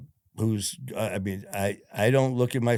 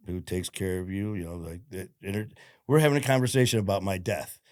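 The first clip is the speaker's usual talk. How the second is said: ordinary talk, nothing marked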